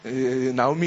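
ordinary talk, nothing marked

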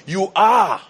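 "You are," high and loud with a hard fall on are; emphatic declaration. Retold or imitated. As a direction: proclaim "You are," as an absolute. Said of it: "very definite and emphatic statement again".

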